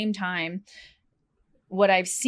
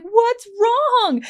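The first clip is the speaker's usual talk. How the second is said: concerned husband; 9 dB louder than usual. Retold or imitated. Husband voiced in retold video.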